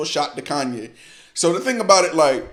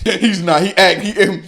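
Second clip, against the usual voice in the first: laughing; 7 semitones higher, 8 dB louder than usual. Real, not acted. Laughing at the rapper's line.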